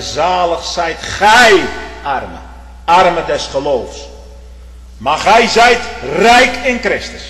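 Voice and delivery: loud, high fervent preaching; impassioned sermon climax; preaching at full force. Acted. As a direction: thunder it from the pulpit.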